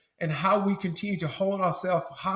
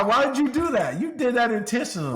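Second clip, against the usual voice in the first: louder, higher mock-indignant teasing; playful accusation; 8 semitones higher, 4 dB louder, faster than usual. Real, not acted. Teasing the cohost for making him cry, mock-indignant. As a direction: accuse her jokingly, fast on the second line.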